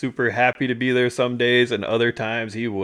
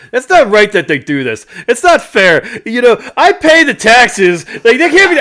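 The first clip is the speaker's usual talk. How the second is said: loud, higher indignant complaint; indignant rant; 11 semitones higher, 10 dB louder than usual. Retold or imitated. Dad complaining to his son that the rule is unfair, loud indignant rant. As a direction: rant about the unfairness, full volume. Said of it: "my dad goes".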